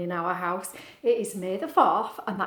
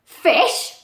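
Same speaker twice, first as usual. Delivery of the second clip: loud, grumpy bark; grumbling; 6 semitones higher, 10 dB louder than usual. Acted. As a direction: spit the word out in disgust. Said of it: "the lobster grumbled".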